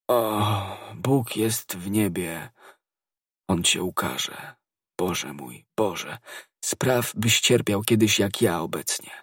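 breath running out as he curses; fading curse. Acted. Dying soldier cursing D'Artagnan, fading.

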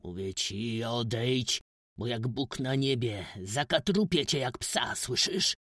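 loud menacing threat; rising loud threat ending in a hiss; shouted again. A narrator's reading. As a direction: threaten him like a furious father. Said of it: "krzyknął znów stary".

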